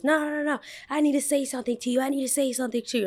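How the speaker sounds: high, fast mock voice of a pushy man; mocking the angry man. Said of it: "He goes".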